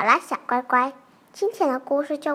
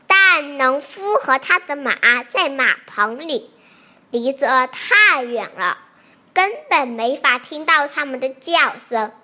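usual speaking voice

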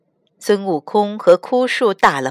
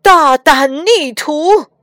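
slow, loud and higher rebuke; stern shout; 6 semitones higher, 6 dB louder, slower than usual. Acted. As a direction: thunder at the disobedient disciple. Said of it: "唐僧喝道".

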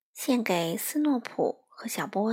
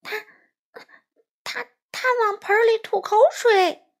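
much higher, louder stuttering gasp; shocked stammer; 11 semitones higher, 6 dB louder than usual. A narrator's reading. Rabbit seeing the crow spit in the sauce, shocked stammering. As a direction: stumble over the words in shock. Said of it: "长耳兔惊得说话直结巴".

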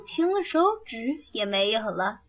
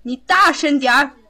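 loud, high bark; bullying shout; 3 semitones higher, 7 dB louder than usual. Acted. Nanny bullies the maid to speak up, shouting. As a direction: yell the order at her.

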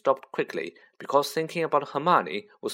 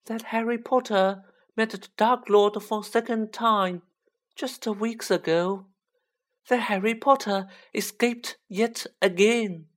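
high-pitched, awed elf voice; small creature's reverent high voice; Dobby, awestruck house-elf; 9 semitones higher than usual. Acted. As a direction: tell it high, marvelling at a hero.

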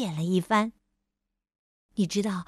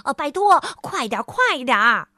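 louder, hurried urging; impatient shout; 5 dB louder than usual. Acted. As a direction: hurry him along impatiently. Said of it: "他不耐烦地喊道".

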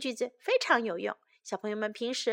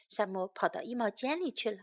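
plain reading voice

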